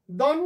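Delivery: দন on its own as one syllable; single syllable. Shown as a demonstration. Teacher showing what one syllable is, single syllable. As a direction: say দন in one short breath, then pause. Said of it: "এটুকু একটা".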